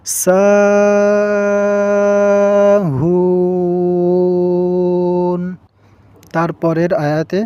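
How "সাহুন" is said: সাহুন with সা and হুন held very long; lengthened vowels. Shown as a demonstration. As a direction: draw out সাহুন slowly in chant. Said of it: "এই সা এখানে আমাদেরকে টান দিতে হবে".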